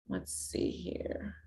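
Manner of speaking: whispered under the breath; whispering. Real, not acted. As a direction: whisper it to yourself. Muttering to self, unvoiced.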